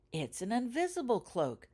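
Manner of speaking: lightly thrilled at seeing the cloak; mild excited exclaim. A narrator's reading. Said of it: "pronounced Layla with excitement".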